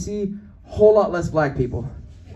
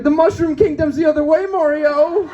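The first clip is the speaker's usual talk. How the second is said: high, loud imitated holler; mock heckling yell; 8 semitones higher, 4 dB louder than usual. Lady taunts comedian on the train, mock heckling yell. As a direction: jeer it high across the car.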